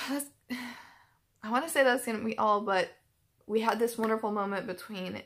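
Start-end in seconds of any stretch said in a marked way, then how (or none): 0.0-0.5 soft, sighing, real tearful voice; sniffly and crying. Real, not acted. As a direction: speak softly through sniffles and sighs. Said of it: "Sniffly and crying".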